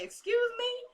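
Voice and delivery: high-pitched, questioning mock offence; retold indignation. Woman retelling a coworker's reply to an insult, high-pitched indignation. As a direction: throw it up high as an affronted question.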